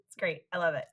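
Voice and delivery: normal talking voice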